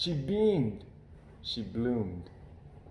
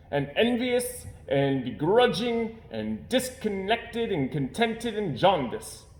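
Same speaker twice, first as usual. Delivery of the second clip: higher, louder, slow list of insults; indignant father; 9 semitones higher, 5 dB louder, slower than usual. Acted. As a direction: pile on every word in outrage.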